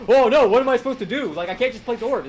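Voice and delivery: put-on "oh no" whine; mock confusion. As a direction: imitate helpless players. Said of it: "'Cause they're like".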